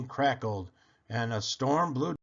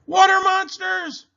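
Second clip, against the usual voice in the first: very high, loud shriek of alarm; panicked high cry; 10 dB louder than usual. A narrator's reading. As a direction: shriek it in storybook terror. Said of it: "and one cried".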